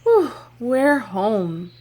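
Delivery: ordinary talk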